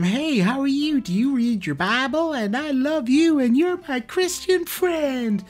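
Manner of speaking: high, slow, sugary friendly voice, mocking; high syrupy mock-friendly tone; mock sweet churchgoer. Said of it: "He goes from".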